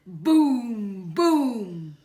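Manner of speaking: very loud booming boom; booming call. A narrator's reading. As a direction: boom it out to shake the ground. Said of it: "A deep, booming noise".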